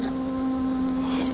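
whispering. Acted. After kiss, teasing whisper.

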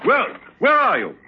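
high, startled demand; alarmed calling out. Acted. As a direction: search the dark with your voice. Calls to unseen intruder.

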